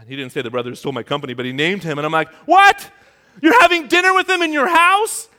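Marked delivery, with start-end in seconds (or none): 2.4-5.3 shouted, much higher, slow stretched How could you; angry disbelief. Retold or imitated. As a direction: explode at dad in disbelief. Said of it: "I got more angry the second time".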